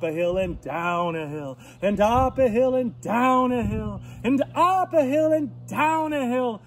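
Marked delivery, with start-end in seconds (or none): 1.8-6.6 sing-song climb, growing higher and louder; rising refrain. A narrator's reading. Storyteller follows the pig over hills, sing-song and building. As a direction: lift each round of the hills.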